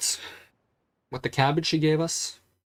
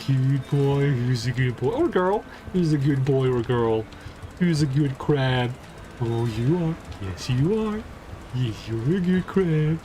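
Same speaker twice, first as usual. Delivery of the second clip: put-on baby talk to pet. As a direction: praise the pet like a puppy.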